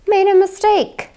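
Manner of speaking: high, loud and astonished; disbelief. A narrator's reading. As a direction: gasp it out in disbelief. Crowd's disbelief, story climax.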